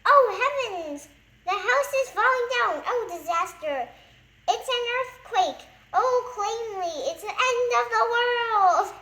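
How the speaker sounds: high, loud hysterical wailing; panic. A narrator's reading. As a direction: wail as if the world is ending. Mister Jelly panics in bed.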